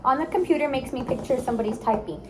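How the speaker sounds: put-on bright, upbeat coaching of little kids; teacher voice to kids. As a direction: cheer them on like a speech therapist. Therapist coaching young kids.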